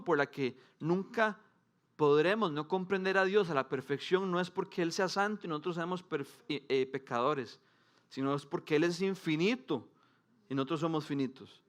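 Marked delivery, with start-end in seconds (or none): none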